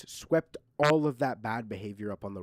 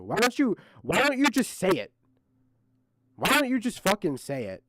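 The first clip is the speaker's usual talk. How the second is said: angry demand; 6 semitones higher than usual. Real, not acted. Demanding he admit it.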